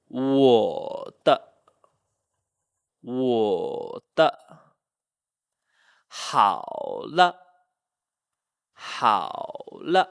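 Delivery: 我 and 好 dip long, 的 and 了 short; neutral-tone ending. Shown as a demonstration. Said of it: "kind of like a toneless sound".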